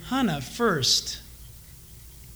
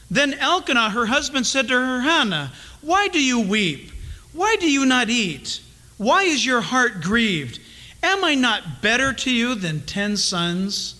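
1 scripture reading; 3 semitones higher than usual. Acted. Preacher reading the scripture passage.

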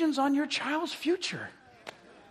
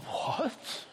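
mock shock; 2 semitones higher, 9 dB quieter than usual.

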